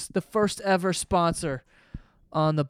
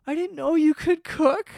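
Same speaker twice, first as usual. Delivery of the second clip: higher, lightly voiced surprised girlfriend; impressed girlfriend voice; 8 semitones higher than usual. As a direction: lift the pitch like an impressed date. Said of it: "And she's like".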